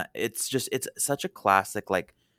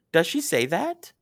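ordinary talk, nothing marked

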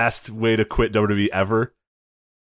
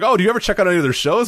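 high, quick, lightly acted enthusiasm; mock-eager question; 10 semitones higher, 4 dB louder, faster than usual. Podcaster replaying a naive question about local shows, mock-eager. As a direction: ask it fast and pitched right up.

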